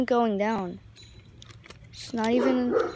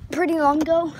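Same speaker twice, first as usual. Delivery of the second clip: catchphrase; 4 semitones higher, 6 dB louder than usual. Shown as a demonstration. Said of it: "so I said it, like, a thousand times".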